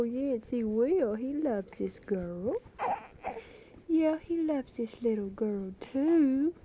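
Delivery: high, loud sing-song cooing to a crying infant. Real, not acted. Mother soothing her crying baby daughter on tape, loud high baby talk.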